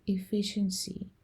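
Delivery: ordinary delivery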